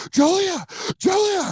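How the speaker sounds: hammed-up alarmed shriek of a name; freaked out. Coworker panicking in the locker room, shrill calling. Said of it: "this other girl fucking freaked out".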